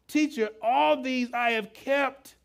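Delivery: preacher voicing the rich young man proudly; proud claim. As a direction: say it like you've kept every rule. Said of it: "What do you hear in his voice?".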